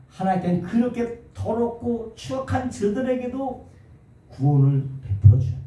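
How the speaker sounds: 더럽고 추악한 저들에게도 lifted high and slowed; stress on 더럽고 추악한 저들에게도. Shown as a demonstration. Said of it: "여기에 강세가 있는 거예요".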